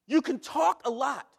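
driving the line home; emphatic. Shown as a demonstration. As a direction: push the point hard. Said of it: "repeated line".

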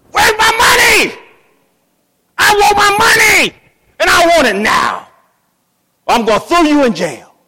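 high, loud shouted threat; furious shout. Retold or imitated. Servant choking his debtor, roaring furious threats. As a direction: roar it, throttling him for pennies. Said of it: "grabbed him by the throat".

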